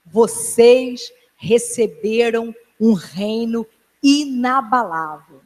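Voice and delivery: drawn out slowly, in a changed voice; slow solemn repeat. Shown as a demonstration. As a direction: press it into their memory, word by word. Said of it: "pra vocês nunca esquecerem". (repetição lenta e solene)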